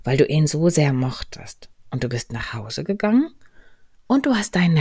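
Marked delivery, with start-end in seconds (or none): none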